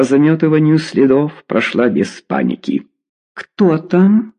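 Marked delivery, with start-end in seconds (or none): 3.3-4.3 high, sweetly innocent query; feigned innocence, pitched way up; innocent little voice. A narrator's reading. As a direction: play innocent in a tiny high voice. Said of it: "невинным голоском осведомилась Лина".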